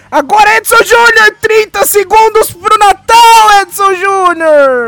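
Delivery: high, loud and slowly stretched announcement; drawn-out excited shout. Real, not acted. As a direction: stretch every word like an overexcited announcer. Countdown to Christmas with his co-host.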